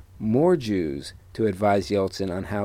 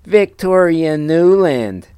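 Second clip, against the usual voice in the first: whiny nasal voice; 7 semitones higher, 8 dB louder, slower than usual. Acted. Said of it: "a weird, whiny, nasally voice".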